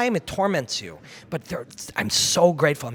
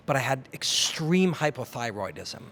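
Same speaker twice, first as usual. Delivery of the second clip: normal talking voice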